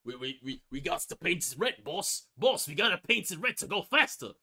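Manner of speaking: mock British accent rising to a loud call; an orc urging the boss on, in British. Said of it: "they speak like British people".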